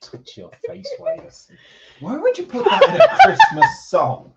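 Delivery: high-pitched, laughing disbelief. Real, not acted. Partner to streamer about a creepy song line, laughing. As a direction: laugh through the question, breaking up.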